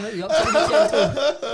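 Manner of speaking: high, slow, laughing and loud; laughing question. Real, not acted. Host asking co-host, still laughing loud.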